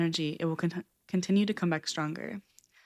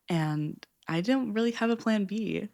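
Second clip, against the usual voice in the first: ordinary delivery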